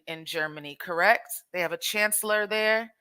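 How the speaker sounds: ordinary talk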